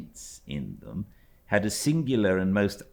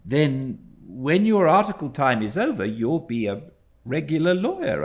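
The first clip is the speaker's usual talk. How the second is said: put-on higher, louder boyish voice; a lightly voiced, louder young boy; young David; 9 semitones higher, 6 dB louder than usual. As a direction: ask like an eager boy.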